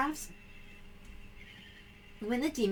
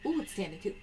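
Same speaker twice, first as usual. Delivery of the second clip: normal talking voice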